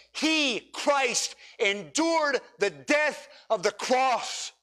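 high, ringing preacher's call; impassioned sermon climax; fervent preaching. Acted. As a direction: plead with the whole room to give their lives. Preaching at full force.

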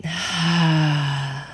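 long open-mouthed exhale; audible sigh. Shown as a demonstration. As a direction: let the breath fall out with a soft ha. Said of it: "and release it".

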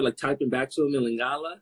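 ordinary talk

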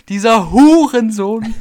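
laut und gedehnt geschimpft; wütend schimpfen. Real, not acted. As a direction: spuck die Beleidigung laut aus. Schimpfen über den Kunden. (Sprecherin lässt Wut über Kunden raus, laut)

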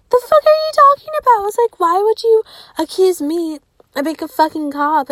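high, loud outraged disbelief; shrill-free loud indignant retort. Retold or imitated. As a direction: fire back offended at being called a cop.

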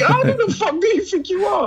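high-pitched, laughing indignation; mock outrage. Podcaster joking to co-host about a strict letter, mock outrage.

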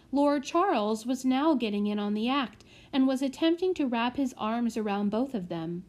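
ordinary delivery

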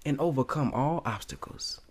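whispered. Acted. Quoting FOI saying, unvoiced.

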